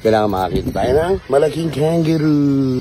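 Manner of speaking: ordinary delivery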